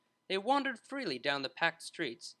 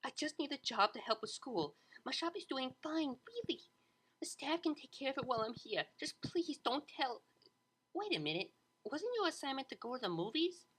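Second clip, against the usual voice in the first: nervous Bon Bon; 9 semitones higher than usual. A narrator's reading. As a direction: plead with them anxiously.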